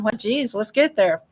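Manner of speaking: ordinary delivery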